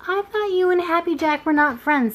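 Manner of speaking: high, doubtful jay voice; jay eyeing the squirrel sharply; Sammy's voice. A narrator's reading. Sammy questions Chatterer sharply.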